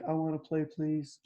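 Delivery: small, high, soft little plea; pleading child. Acted. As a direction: ask softly like a child wanting to join in. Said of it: "She's like".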